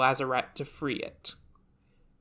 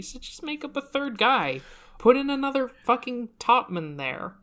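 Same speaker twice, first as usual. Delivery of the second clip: higher, insistent "So just make up a third guy"; insistent repeat; 8 semitones higher than usual. Real, not acted. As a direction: push the same idea harder, exasperated. Repeating the fix more insistently.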